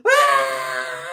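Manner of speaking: high, loud hammed-up horror scream; mock scream. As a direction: shriek like a movie heroine. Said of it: "start screaming now".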